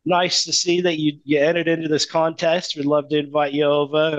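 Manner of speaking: high, bright-ish Aussie telemarketer voice, pitched well up; Australian accent. Retold or imitated. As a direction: put on a cheery Australian accent to hook the caller. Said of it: "Australian accents".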